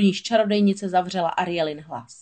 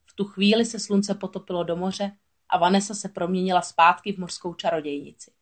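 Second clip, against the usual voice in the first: usual speaking voice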